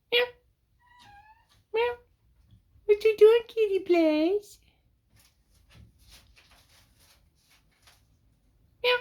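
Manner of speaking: very high, slow sing-song pet voice. Real, not acted. Talking to Kitty Blaze in the loft, high cooing pet voice. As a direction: coo sweetly up at the kitty. Baby talk to the cat.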